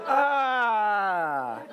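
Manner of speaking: long, drawn-out cry of pain; drawn-out "aah", sliding down; mock pained yell. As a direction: act out a stinging water landing. Hitting water with arms out.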